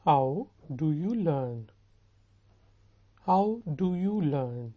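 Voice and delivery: very slow, drawn-out words; slow model question. Shown as a demonstration. Teacher modelling a question for students, slow and deliberate. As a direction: stretch each word for learners.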